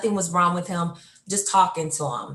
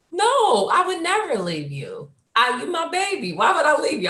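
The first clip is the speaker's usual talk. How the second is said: reassuring dad, high; 10 semitones higher than usual. Retold or imitated. Dad reassuring crying child.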